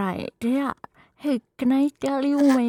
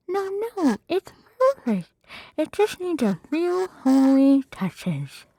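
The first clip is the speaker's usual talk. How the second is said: light, lifted princess voice, much higher; 4 semitones higher than usual. Acted. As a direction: play the princess chirping, cheery and high. Fiona voice, high.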